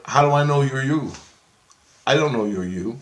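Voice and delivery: high, loud suspicious demand; suspicious challenge. Retold or imitated. Man refusing to let Chris in, loud suspicious challenge.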